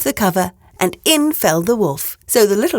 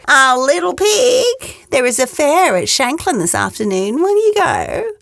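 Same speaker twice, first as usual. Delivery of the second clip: sly wolf; 6 semitones higher than usual. Acted. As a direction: tempt the pig sweetly. Wolf invites to fair.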